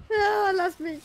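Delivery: high pleading cry; frightened cry. Real, not acted. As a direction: beg to be left alone. Being attacked by killer.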